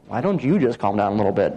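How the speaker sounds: low, quick aside in a listener's voice; doubting listener. Acted. Preacher voicing this morning's doubters, low muttered objection. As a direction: drop low and toss out the objection. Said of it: "some of you may have been thinking".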